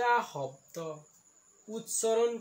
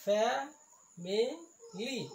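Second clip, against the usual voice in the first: "Family" in three slow beats; syllable by syllable; much slower than usual. Shown as a demonstration. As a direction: stretch and separate each syllable of "family". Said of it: "ইয়াত তিনিটা চিলেবুল আছে". ("Family" তিনিটা ভাগত ভাঙি লাহে লাহে)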